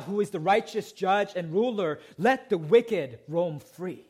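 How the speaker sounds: high, loud sermon climax; preaching at full force. Acted. Preacher challenging the congregation with the problem of sin, rising and loud. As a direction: press the question hard.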